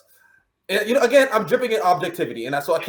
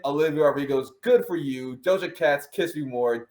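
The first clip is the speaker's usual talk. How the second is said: ordinary talk, nothing marked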